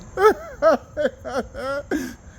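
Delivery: high-pitched laugh that cracks toward crying; high laugh breaking. Real, not acted. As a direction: laugh it out high, almost tearful. Overcome with laughter.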